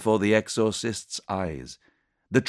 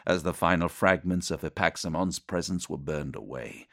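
plain reading voice

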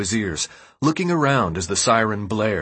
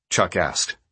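usual speaking voice

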